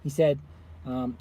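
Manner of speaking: normal talking voice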